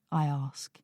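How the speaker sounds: ordinary delivery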